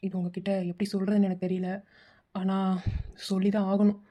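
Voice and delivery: low, halting bad-news voice; grave, hesitant. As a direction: break terrible news carefully, with pauses. Caller breaks news of death.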